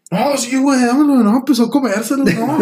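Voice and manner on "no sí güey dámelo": high, eager mock demand; hungry guy imitation. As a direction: play a hungry friend grabbing at food, pitched way up. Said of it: "un vato que traía hambre".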